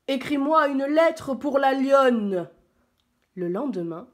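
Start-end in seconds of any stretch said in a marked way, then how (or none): 0.1-2.5 louder, higher, drawn-out order; commanding lion. A narrator's reading. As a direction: order it loudly and slowly, as the lion. (lion autoritaire, voix forte)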